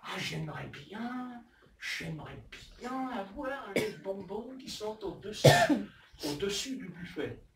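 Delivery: put-on slow, drawn-out child's longing; wishing child. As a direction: stretch the words like a child craving sweets. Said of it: "le petit garçon, la petite fille, il se dit".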